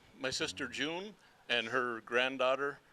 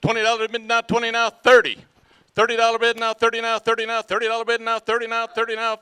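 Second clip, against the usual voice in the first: loud, high auction chant; auctioneer; 7 semitones higher, 15 dB louder than usual. Acted. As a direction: call the bids fast and steady. Auction chant, second item.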